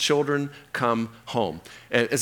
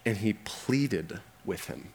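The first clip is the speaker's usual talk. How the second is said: normal talking voice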